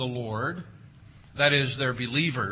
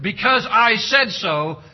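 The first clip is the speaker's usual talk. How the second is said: louder, slower quoted parent line; mock parent decree; 6 semitones higher, 8 dB louder, slower than usual. Preacher quotes the parent's line to parents, loud. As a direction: give it the parent's weight again. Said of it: "How is it different?".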